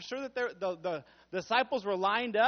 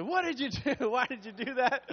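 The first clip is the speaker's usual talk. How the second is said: 1 mild mock yell; 5 semitones higher, 4 dB louder than usual. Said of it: "Taj just screamed and said".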